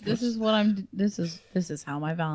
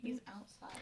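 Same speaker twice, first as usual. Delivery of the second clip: real hushed whisper; 2 semitones higher, 21 dB quieter than usual. Real, not acted. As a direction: whisper so dad won't hear. Dad is near, hushed warning.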